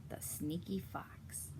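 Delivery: sneaky hushed whisper. Acted. Teacher pointing out the sneaky fox to kids, whispering. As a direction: whisper it like a secret. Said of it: "whispering about the fox".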